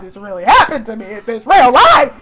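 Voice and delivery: loud, emphatic disbelief; overwhelmed fan outburst; freaking out. Retold or imitated. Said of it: "I was, like, freaking out".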